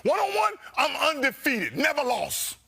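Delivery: high shouted brag; escalating boast. Pretend. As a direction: yell it like a proud braggart.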